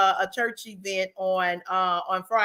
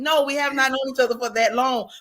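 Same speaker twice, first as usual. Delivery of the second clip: ordinary talk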